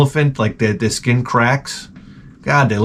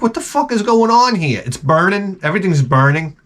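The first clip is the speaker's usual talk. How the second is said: high, alarmed yelp of a man whose face burns; squealing hammed-up alarm; mock panic; 6 semitones higher than usual. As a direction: cry out in pretend panic at the burning. Panicked reaction to burning cream.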